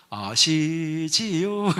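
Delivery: very slow sly coaxing question; drawn-out wheedle. Retold or imitated. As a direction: stretch each syllable, coaxing. Worker pleading innocence. (아주 느리게 늘어뜨린 능청스러운 물음)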